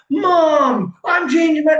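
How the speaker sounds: high, loud eager shout; telling mom about the change, excited; excited announcement. Retold or imitated. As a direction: burst out to mom. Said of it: "I was like".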